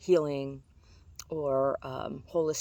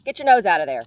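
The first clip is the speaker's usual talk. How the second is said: much louder sharp command; scolding the dog; 10 dB louder than usual. Real, not acted. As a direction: call the dog off firmly.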